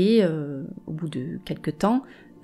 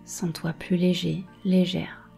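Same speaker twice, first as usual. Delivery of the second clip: guided meditation. Acted. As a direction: calmly guide each image. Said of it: "Je vais te guider dans une méditation".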